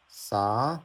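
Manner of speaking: "sa" rising to the end; second tone. Shown as a demonstration. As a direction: slide "sa" upward. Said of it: "the logic is all the same".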